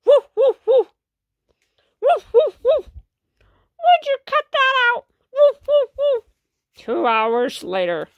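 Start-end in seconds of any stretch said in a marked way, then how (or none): 0.0-6.2 loud high barking dog. Acted. Kid acting out Dogman barking, loud high barks. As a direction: bark it out like an excited dog. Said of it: "Dogman goes".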